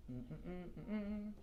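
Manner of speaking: short hummed "mm" sounds; absent-minded humming. Real, not acted. Teacher filling time before giving the formula, humming softly. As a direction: hum little notes while thinking. Hums while finding the formula.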